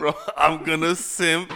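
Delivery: slow, high, loud, laughing through it; cracking up mid-quote. Retold or imitated. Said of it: "He said".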